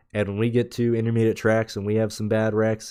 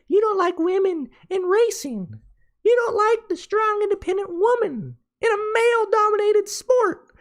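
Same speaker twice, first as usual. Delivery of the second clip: squeaky falsetto whine, much higher; mocking a whiny online critic in high falsetto. Podcaster mocking critics in a high falsetto whine. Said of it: "This would be".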